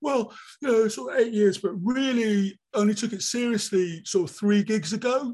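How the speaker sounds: mock comedian excuse. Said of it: "and they go".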